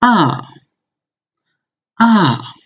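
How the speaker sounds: ah falling sharply from high; fourth tone ah. Shown as a demonstration. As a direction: drop ah hard. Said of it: "I will say them twice".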